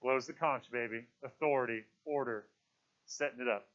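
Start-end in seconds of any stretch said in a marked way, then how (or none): none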